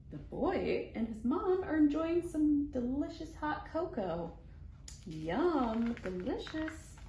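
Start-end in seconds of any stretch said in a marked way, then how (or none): none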